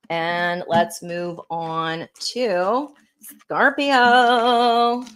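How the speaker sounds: stretched out, grand and slow; dramatic slow announcement. Real, not acted. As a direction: drag every word like a showman. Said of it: "I don't know why I just said it like that".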